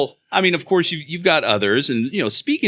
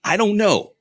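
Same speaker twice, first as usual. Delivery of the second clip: high, mock-baffled protest; a touch of pleading exasperation; exasperated visitor; 10 semitones higher, 4 dB louder than usual. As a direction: play the friend stuck outside, at a loss. Acted visitor answering paranoid friend.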